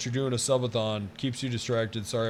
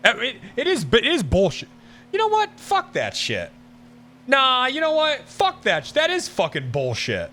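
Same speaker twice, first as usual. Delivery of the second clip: working himself up into real anger; getting angry; 8 semitones higher than usual. Real, not acted. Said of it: "now I'm getting even more angry".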